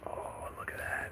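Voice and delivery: whispered, hushed. Real, not acted. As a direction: breathe it out softly. Whispers at spider web.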